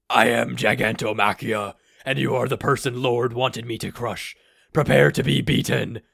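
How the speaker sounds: loud, booming giant voice. A narrator's reading. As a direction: thunder like a giant. Giant announces himself.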